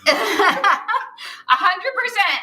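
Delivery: drawn out through laughter, very slow; laughing. Real, not acted. Laughing agreement with friend.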